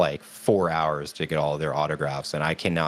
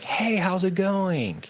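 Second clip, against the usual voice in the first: high, slow breathy-free falsetto greeting, mocking a bored celebrity; squeaky high mock greeting, drawn out slowly; imitating Sam; slower than usual. Fan mimics Sam greeting her at the autograph table, high and slow. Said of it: "And he's like".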